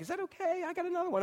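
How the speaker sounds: put-on high, soft girly voice; put-on girlish pleading; imitated young girls. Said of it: "they're like".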